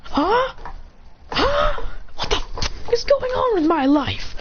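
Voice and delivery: high, bewildered huhs into drawn-out outburst; acted bewilderment. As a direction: reel in exaggerated confusion. Character reels from revelation.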